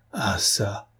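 ordinary delivery, nothing marked

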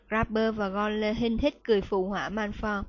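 usual speaking voice

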